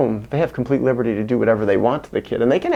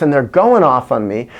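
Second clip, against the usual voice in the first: ordinary delivery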